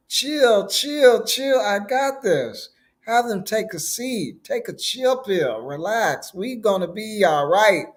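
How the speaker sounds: high-pitched, easygoing soothing act; laid-back Jesus. Retold or imitated. Jesus reassuring his worried disciples, playfully laid-back. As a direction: play Jesus telling everyone to chill, voice lifted high. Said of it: "in his ever so common voice".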